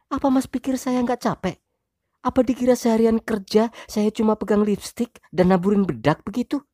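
high, loud wife voice, resentful. Acted. Wife complaining she is tired too, high loud voice. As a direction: fight back as the tired wife. Wife's angry speech.

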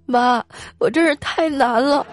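slow, high, put-on sobby complaint; mock choked-up. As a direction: whine to mum as if choking back tears. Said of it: "然后哽咽着说". (假装哽咽着诉苦)

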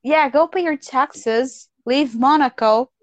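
normal talking voice

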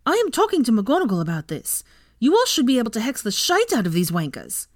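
high, heated indignant rant; angry. A narrator's reading. Ginny fumes to Hermione after the hex, angry. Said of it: "Ginny was red with anger".

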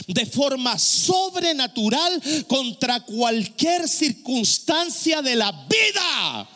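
slow, stretched-out pulpit proclamation; impassioned sermon cry, words drawn out; forceful preaching. Acted. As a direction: build to a shouted climax.

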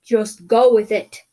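loud, slow creature order; yelling back. Acted. Creature carrying someone to safety, yelling back. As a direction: yell it back firmly. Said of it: "the creature yelled back at me".

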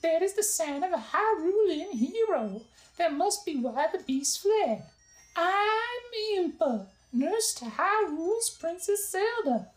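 high, slow voice for a worried nurse; Impa's high voice. Acted. Narrator voicing Impa to Link, high and anxious. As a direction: lift way up into a fretful lady's voice.

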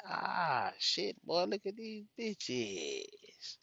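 high, slow, drawn-out mock amazement; mock impressed. At a house party, spotting the women, high and slowly amazed. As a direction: stretch it out, eyes wide, high and slow. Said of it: "I'm like".

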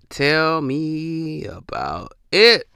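slow, stretched "Tell me about it"; drawn-out knowing echo. Real, not acted. Agreeing with himself about angry girls, slow and drawn out. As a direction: let each word drag, ruefully knowing. Rueful echo of own remark.

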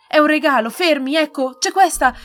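high, loud pleading; desperate girl. A narrator's reading. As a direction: shout pleading to save the painting. Girl begs soldiers to stop.

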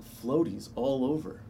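ordinary delivery

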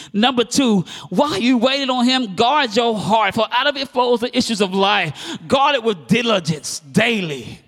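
high, loud sermon delivery; full-force preaching. Real, not acted. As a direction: drive each point home to the congregation.

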